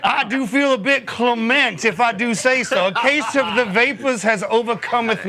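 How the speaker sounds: high, slow fainting Southern belle; Southern lawyer's wife. Acted. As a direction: swoon through it like a belle. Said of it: "It sounds like a Southern lawyer's wife".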